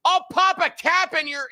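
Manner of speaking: very high hammed-up threat; mock yell. Host imitating the woman yelling at the fleeing repairman, very high mock yell. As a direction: squeal it like a cartoon gangster threat. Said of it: "Miss Reba LaJoy Wilson yelled".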